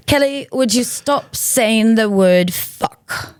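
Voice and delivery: slow, deliberate scolding in a put-on voice; drawn-out weary rebuke of a toddler; slow, exasperated mother telling off her daughter; Sharon's scolding. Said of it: "finally Sharon looks at her and she goes".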